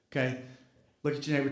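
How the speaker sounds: normal talking voice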